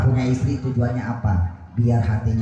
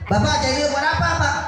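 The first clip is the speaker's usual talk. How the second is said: high fervent preaching; faster than usual. Acted. Preacher raises voice to crowd.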